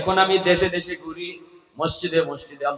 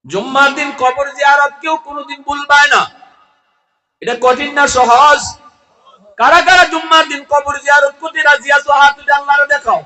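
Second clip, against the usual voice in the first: high, loud exhortation to the crowd; impassioned high-pitched preacher's rallying cry; full-force preaching; 12 semitones higher, 11 dB louder than usual. Real, not acted. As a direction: shout the challenge to a congregation.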